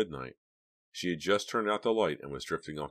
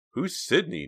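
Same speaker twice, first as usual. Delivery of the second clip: joking; 13 semitones higher, 7 dB louder, slower than usual. A narrator's reading. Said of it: "Dina joked".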